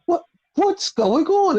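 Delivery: high, slow, bewildered question; mock baffled MJF voice. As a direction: play it totally lost and confused. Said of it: "He was like".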